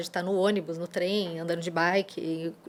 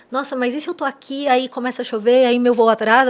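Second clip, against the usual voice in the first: worried what-if spiral, louder and higher; mock panic, building louder; anxious catastrophizing; 3 semitones higher, 10 dB louder than usual. Guest imitating someone worrying about a delayed flight, mock-anxious. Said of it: "a gente conecta com o apocalipse".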